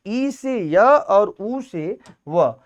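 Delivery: ई से य और उ से व dragged out very slowly, word by word; slow dictation. Shown as a demonstration. Teacher dictates the यण संधि rule slowly to students. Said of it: "teacher restates rule slowly".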